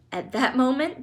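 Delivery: ordinary delivery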